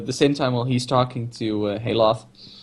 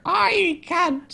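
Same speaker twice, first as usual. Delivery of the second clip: squeaky voice. Retold or imitated. Voicing Crichton, squeaky.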